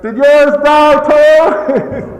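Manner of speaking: very slow, high, loud yell; yelled reply. Retold or imitated. Sponsor yelling back at the guy by the door, drawn-out high yell. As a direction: shout it back, drawing it out. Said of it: "the sponsor yells back".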